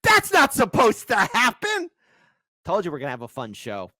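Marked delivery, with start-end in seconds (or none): 0.0-1.9 mocking panic. Mocking politicians' reaction.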